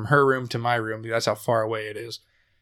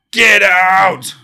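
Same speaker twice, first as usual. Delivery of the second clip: sudden loud shout, much higher; 8 semitones higher, 11 dB louder than usual. Retold or imitated. Possessed brother yells at the siblings, sudden shout. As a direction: bark it out in his face, high and loud.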